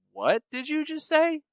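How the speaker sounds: high, incredulous question; can't believe what he heard; stunned disbelief. Retold or imitated. Stunned reply.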